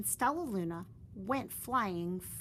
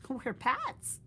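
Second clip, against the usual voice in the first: a lightly voiced mother bat; 6 semitones higher, 4 dB louder than usual. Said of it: "said Mother Bat".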